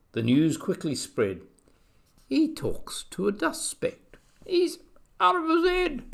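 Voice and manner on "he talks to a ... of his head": very high-pitched gossipy voice; gossiping jungle animal. A narrator's reading. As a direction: spread it as high, scandalised gossip. Jungle gossip mocking Horton.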